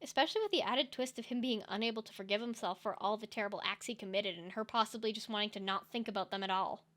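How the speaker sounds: ordinary talk, nothing marked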